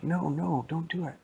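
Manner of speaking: put-on urgent little kid pleading; high, pleading child voice. Kids warn the thirsty boy.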